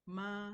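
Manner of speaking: Ma, held high and level; first tone ma. Shown as a demonstration. Teacher showing the word for mom. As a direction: sing Ma, on a high flat note. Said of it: "that of the mom".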